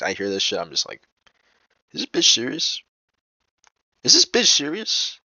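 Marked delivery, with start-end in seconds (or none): 1.9-5.2 high-pitched, disbelieving question; incredulous. Retold or imitated. Reacts to her asking his name.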